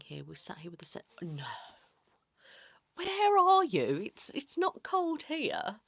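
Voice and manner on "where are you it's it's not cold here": much higher, louder and slow, playfully indignant; mock disbelief. Real, not acted. Answering a viewer who says it's warm, loud mock disbelief. Reacting to viewer weather comment.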